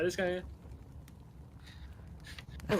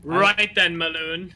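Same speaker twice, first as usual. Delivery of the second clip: loud, commanding challenge; authoritative orc; 4 dB louder than usual. Acted. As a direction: slam the table and declare it. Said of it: "authoritative manner and goes".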